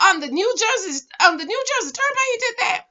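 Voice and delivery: high-pitched, incredulous replay; can't-believe-it squeal of a question; retold disbelief. Retelling her shocked reaction.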